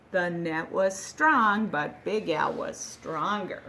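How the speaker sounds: ordinary delivery, nothing marked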